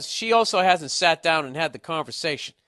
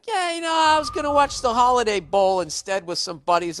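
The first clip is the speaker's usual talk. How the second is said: put-on boyfriend's excuse; 10 semitones higher, 5 dB louder than usual. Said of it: "And you'd be like".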